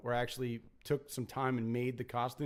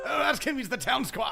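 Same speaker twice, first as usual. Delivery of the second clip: pirate voice; 10 semitones higher, 6 dB louder than usual. Retold or imitated. Quoting pirate video character.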